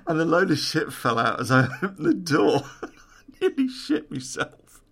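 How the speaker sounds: giggling through the words, pitch jumping up; laughing. Real, not acted. Speaker cracking up retelling the cupboard fright, laughing. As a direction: laugh helplessly at your own scare.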